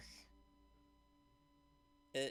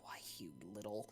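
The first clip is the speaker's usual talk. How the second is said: furious breathy whisper, softer, clipped off; seething whisper; 4 semitones lower, 10 dB quieter, faster than usual. Acted. Dragonborn fumes at the kobold, an angry whisper. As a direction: choke the insult out under your breath.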